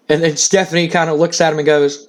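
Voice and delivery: usual speaking voice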